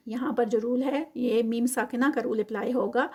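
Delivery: ordinary talk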